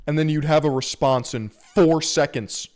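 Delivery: ordinary delivery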